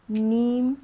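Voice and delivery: नीम with नी stretched long; long vowel. Shown as a demonstration. Teacher models the long ई: नीम. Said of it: "नी लंबा खिंचता है".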